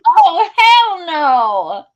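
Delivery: slow, loud, high "hell no"; outraged. Retold or imitated. Woman turning on the guy who poured water down her back, outraged. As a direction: stretch it out, furious.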